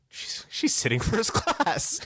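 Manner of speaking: high, slow squeal of mock outrage; retold indignation with a laugh breaking in; indignant disbelief. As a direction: squeak it out, comically scandalized. Outrage at wife's upgrade.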